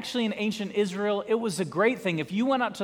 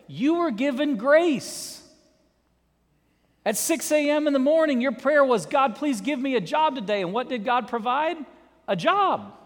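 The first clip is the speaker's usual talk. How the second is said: rising pulpit intensity; full-force preaching; 6 semitones higher, 3 dB louder than usual. Acted. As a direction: preach it up with fervor.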